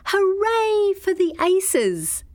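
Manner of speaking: very high, loud, slow cheer; shouted very loudly. A narrator's reading. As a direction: stamp and yell for the Aces. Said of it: "She shouted very loudly".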